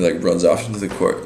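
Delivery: usual speaking voice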